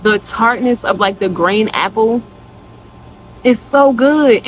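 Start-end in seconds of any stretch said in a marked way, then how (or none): 3.4-4.5 excited rave. Real, not acted. Raving about scent.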